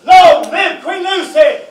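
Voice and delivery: rally everyone in the cheer; ringing ceremonial acclamation; proclaiming shout. Acted. Coronation acclaim continues.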